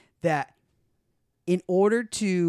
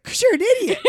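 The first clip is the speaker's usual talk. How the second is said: much higher, laughing tease; laughing insult; 9 dB louder than usual. Retold or imitated. Teasing her friend on the phone, laughing. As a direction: call him an idiot through laughter. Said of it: "I was like".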